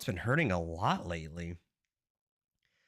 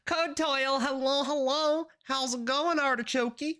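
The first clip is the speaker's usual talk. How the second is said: high, bouncy put-on voice greeting viewers; cutesy high greeting; 13 semitones higher than usual. Streamer greeting chat viewers by name in a squeaky high voice. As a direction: greet them in a squeaky cartoon voice.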